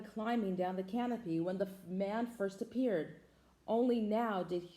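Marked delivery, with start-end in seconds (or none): none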